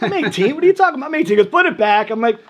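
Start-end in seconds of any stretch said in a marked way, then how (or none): 0.0-1.4 high, loud incredulous protest; mock outraged young man; indignant protest. Arguing with bouncer, indignant. 1.5-2.1 loud, higher curt command; gruff mock bouncer; bouncer order.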